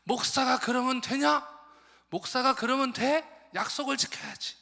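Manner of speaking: high, loud and indignant nagging; an old deaconess berating the late missionary, loud and sharp; scolding old lady. Retold or imitated. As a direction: scold the late pastor like an offended elder. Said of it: "또 제조하기 시작했어요".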